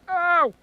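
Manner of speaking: high sharp cry of pain; loud ow. Real, not acted. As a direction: yelp ow at the burn. Just burned fingers.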